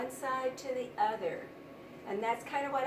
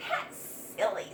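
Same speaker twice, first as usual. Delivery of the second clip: scoffing mouse; 4 semitones lower than usual. A narrator's reading. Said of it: "said another".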